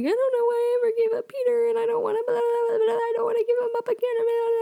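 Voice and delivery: high-pitched hammed-up wail; exaggerated imitation of weepy babbling; mock crying. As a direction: blubber it out in one high mock sob.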